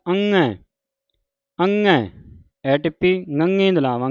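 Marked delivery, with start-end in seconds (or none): 0.1-2.0 ਅੰਗੈ with "ng" sounded in the nose; nasal ਅੰਗੈ. Shown as a demonstration. Teacher demonstrating pronunciation, nasal ਅੰਗੈ. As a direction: send the middle of ਅੰਗੈ through the nose. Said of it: "ਨੱਕ ਦੇ ਵਿੱਚ".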